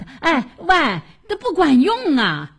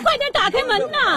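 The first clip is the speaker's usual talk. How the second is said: high, pressing cry; urgent alarm; 10 semitones higher than usual. Acted. Neighbour begging them to open the door, alarmed. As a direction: urge them on in a raised pitch. Urges opening the door for child.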